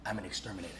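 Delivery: low and quiet deadpan; low, hushed exterminator in character. Retold or imitated. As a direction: murmur it like a serious exterminator. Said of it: "I'm locked in character".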